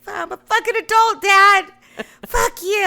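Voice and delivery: high, loud mock yell at dad; mock outburst at dad. Retelling a fight, yelling at her dad. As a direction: fling it back like a fed-up daughter. Defying dad in retold story.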